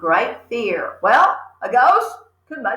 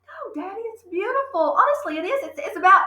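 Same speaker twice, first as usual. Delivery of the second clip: put-on little girl pleading; 10 semitones higher, faster than usual. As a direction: beg him gently like a child. Matilda pleads again.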